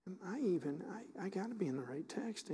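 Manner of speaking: ordinary delivery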